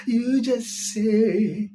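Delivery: slow, drawn-out officiant cue; mock pastor prompt. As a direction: drawl it like a pastor leading vows.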